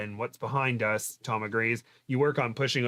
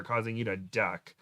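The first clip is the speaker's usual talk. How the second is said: plain reading voice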